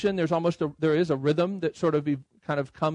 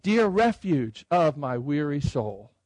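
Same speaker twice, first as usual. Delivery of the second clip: slow, measured reading of the line; a slow recitation; 3 semitones lower, slower than usual. Shown as a demonstration. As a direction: let the line hang, then pause. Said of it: "you have to pause after that line".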